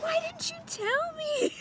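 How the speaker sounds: high, slow indignant squeal; indignant outcry. Retold or imitated. To girlfriends who never warned her, a high, slow, indignant squeal. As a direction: squeal it at your friends. Complaining to friends.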